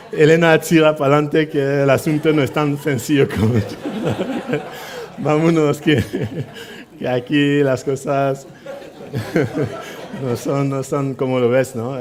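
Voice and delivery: father hurrying his daughter away, laughing. Retold or imitated. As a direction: laugh through the words urging the daughter on. Laughing, urging daughter to move on.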